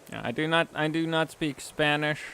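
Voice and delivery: usual speaking voice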